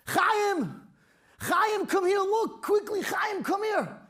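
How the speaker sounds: high, urgent calling to a friend. Acted. Man calling Chaim to come see, high urgent call.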